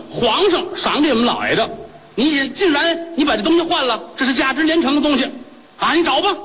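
high-pitched indignant scolding; furious accusation. Acted. Wife accusing the shopkeeper of swapping her pearl, shrill indignant rant.

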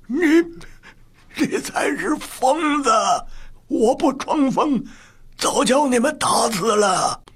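very high, strained furious outburst; angry growl. A narrator's reading. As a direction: lash back in rage at the accusation. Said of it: "怒声低吼". (激动愤怒地嘶吼)

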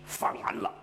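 ordinary delivery, nothing marked

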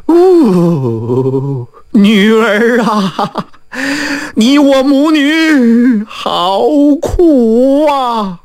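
long, drawn-out sobbing lament; wailing old mother. A narrator's reading. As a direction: wail over your lost daughter, stretching each word. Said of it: "别哭了，别哭了".

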